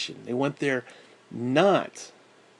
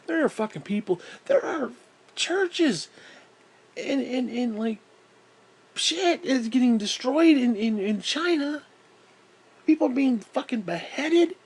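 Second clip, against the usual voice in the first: higher, urgent indignation; heated; 9 semitones higher than usual. Real, not acted. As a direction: push it up in pitch, outraged. Heated about real persecution.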